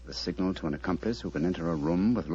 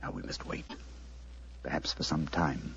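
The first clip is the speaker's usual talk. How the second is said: low, softer instructions in the dark; hushed; 5 semitones lower, 7 dB quieter than usual. Acted. Holmes giving Watson hushed orders for the vigil. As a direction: murmur the orders so nothing carries.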